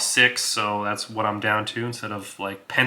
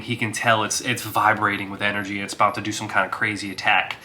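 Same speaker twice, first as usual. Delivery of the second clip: usual speaking voice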